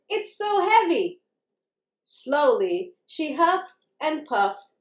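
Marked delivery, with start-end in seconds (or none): 0.1-1.2 high, mildly strained complaint; little mouse crying out, understated; storybook cry. A narrator's reading. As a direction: complain lightly in a higher voice. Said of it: "she cried".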